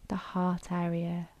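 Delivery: unhurried, even delivery, leaving room to breathe; guided meditation. Acted. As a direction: guide them gently into stillness, phrase by phrase.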